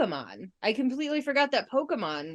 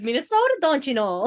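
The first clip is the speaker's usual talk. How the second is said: lightly hammed-up Minnesota accent; mock Minnesota accent; 3 semitones higher than usual. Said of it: "but in that accent".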